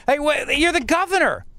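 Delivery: ordinary talk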